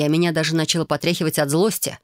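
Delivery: ordinary delivery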